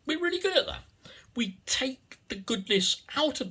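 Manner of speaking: squeaky little sparrow boast; high chirpy voice. Pretend. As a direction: chirp it up high like a tiny bird. Chirpy sparrows proud of themselves.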